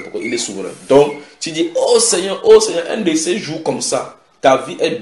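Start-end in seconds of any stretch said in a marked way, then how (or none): 1.8-4.2 high, raised lament to the Lord; imitating an imploring believer; pleading prayer. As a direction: wail lightly in complaint. Acts out a person praying.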